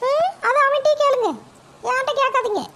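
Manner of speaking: high, sharp-voiced village mother answering her mother-in-law; mother's voice. Mother answers her mother-in-law, high and curt. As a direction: pitch it up as the boy's cranky mother.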